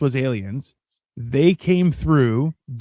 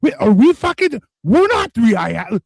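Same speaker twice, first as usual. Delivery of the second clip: high, hammed-up panic as lost aliens; panicked alien; 9 semitones higher than usual. Alien crew panicking about their own identity, high hammed-up voice. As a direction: squeak out a stunned realization. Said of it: "and they're like".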